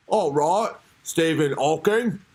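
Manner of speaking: slow, hammed-up British reply; exaggerated British sign-off, stretched out; mock British interviewer. Host as questioner closing with Hawking, mock British accent. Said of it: "sound like a British".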